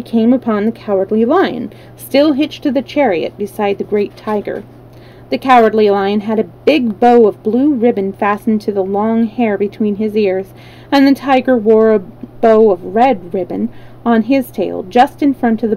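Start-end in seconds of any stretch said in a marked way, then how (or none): none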